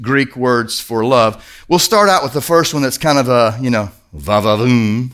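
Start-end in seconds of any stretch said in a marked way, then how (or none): 4.1-5.1 low, suggestive mock-sultry aside; hammed-up innuendo; playful va-va-voom. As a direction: draw out the voom, tongue in cheek. Playful name for sexual love.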